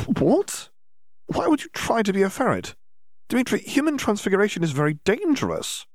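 alarmed at the danger; astonished. A narrator's reading. Hermione reacts to ferret.

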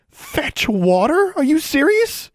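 very high, loud outraged shriek; indignant. A narrator's reading. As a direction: draw out the first words in outraged disbelief. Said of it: "turned red with indignation".

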